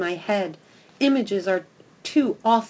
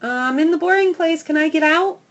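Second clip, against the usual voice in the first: high, loud pleading puppy voice; eager dog barking; 7 semitones higher, 7 dB louder than usual. A narrator's reading. Dog at the gate calling downstairs, high and loud. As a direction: beg to be let out, bright and high. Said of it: "I bark and bark".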